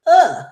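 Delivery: loud high disgusted groan; disgusted ugh. Real, not acted. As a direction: groan of real annoyance. Disgust at Haley.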